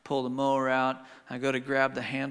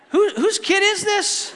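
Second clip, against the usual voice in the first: much higher, louder, slow through laughter; laughing disbelief; 9 dB louder than usual. Real, not acted. Father laughing at his son's toy box mishap, high and loud. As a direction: throw up your hands, laughing.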